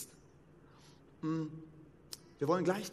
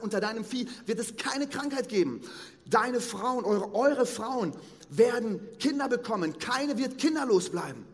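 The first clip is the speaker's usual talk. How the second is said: raised, higher, louder fervent sermon voice; preaching at full force; 6 semitones higher, 6 dB louder than usual. Acted. Preacher to the congregation about Abraham, fervent preaching. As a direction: proclaim it like a revival preacher.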